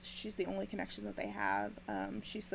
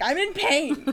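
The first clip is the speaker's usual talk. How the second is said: loud, laughing cry of pain; hammed-up wail of hurt; mock pained yell; 6 semitones higher, 11 dB louder, slower than usual. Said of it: "I'm like".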